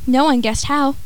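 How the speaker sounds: ordinary delivery, nothing marked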